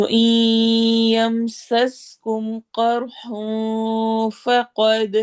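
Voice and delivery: slow melodic tajweed chant; measured Quranic recitation. Shown as a demonstration. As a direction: recite slowly, stretching the long vowels. Teacher recites Quran verse.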